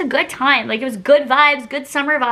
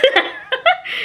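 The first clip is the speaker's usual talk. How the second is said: high-pitched giggle after a cheeky plug; 14 semitones higher than usual. Real, not acted. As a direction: giggle up high at your own joke. Laughs at own plug.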